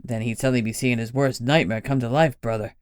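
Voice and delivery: grimly smiling brother; grim smile. Acted. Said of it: "Lenny smiled grimly".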